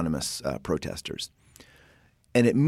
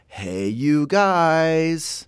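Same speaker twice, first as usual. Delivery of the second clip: a drawn-out, higher, louder hey you guys; slow, raised call to a group of friends; 6 semitones higher, 5 dB louder, much slower than usual. Shown as a demonstration. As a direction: call across the room to get a group's attention. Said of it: "as in".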